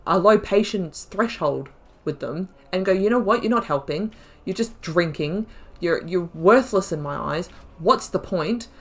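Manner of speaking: ordinary talk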